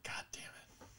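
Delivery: breathy whispered curse under the breath. Retold or imitated. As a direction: hiss it out, no voice at all. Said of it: "and then I'm just like".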